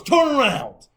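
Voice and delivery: loud, high imitation of a guard's command; shouted order. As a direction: order him round at full volume. Officer's shouted order, acted out.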